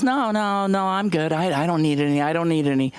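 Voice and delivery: lightly voiced diners politely saying no; polite refusal. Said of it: "And everybody goes".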